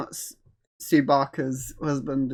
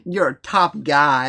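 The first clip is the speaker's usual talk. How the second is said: put-on dodgy American accent; 6 dB louder, slower than usual. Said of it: "they all start speaking with that dodgy American accent".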